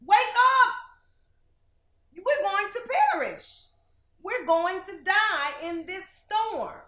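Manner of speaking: high, loud panicked cries; panicked disciples. Acted. Frightened disciples shouting at sleeping Jesus, panicked. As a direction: scream at him to wake up. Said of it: "they're like".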